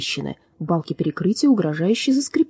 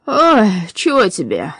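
slow, groggy just-woken voice; sleepy; 5 dB louder, slower than usual. A narrator's reading. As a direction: sound freshly woken and drowsy. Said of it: "спросонья спросил он".

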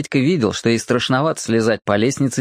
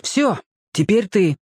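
put-on higher, slow boyish urging; boy's voice; 7 semitones higher than usual. A narrator's reading. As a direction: Borka telling Petka his turn. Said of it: "ответил Борька". (высокий мальчишеский голос)

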